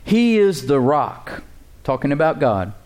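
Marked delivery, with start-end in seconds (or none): 0.0-1.4 slow, weighty reading of scripture. Real, not acted. Pastor reading the verse to the church, slow and weighty. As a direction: lean on each word of the verse. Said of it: "Look at the words here with me".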